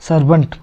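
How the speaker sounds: सर्वेंट as "SAR-bunt", second vowel reduced; "sur-bunt" for सर्वेंट; correct pronunciation. Shown as a demonstration. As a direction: model सर्वेंट with a short "uh" before "nt". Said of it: "जबकि इसका सही उच्चारण क्या है?".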